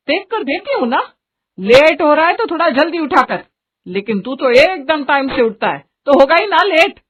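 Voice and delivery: fed-up mother nagging in a lifted pitch; scolding mother. Acted. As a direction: tell off your impatient son. Narrator plays the scolding mother.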